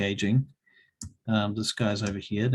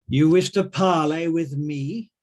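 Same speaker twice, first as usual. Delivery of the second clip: raised, slow drow challenge, lifting at the end; calling out; 6 semitones higher, 6 dB louder than usual. Acted. Drow calls across the room to parley, calling out. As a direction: call it loudly across a room, drawn out. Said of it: "she calls out".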